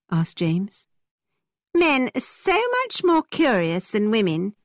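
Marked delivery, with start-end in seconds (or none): 1.7-4.6 high, slow, playful teasing. A narrator's reading. Isabella teases James about his curiosity, playfully. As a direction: tease him in a raised, lilting voice. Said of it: "teased Isabella".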